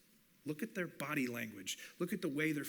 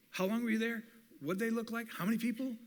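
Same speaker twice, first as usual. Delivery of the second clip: curious questions; 5 semitones higher than usual. Pretend.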